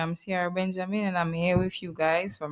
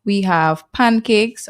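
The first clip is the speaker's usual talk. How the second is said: proud announcement; 2 semitones higher, 9 dB louder, slower than usual. Real, not acted. Proudly presenting breakfast menu.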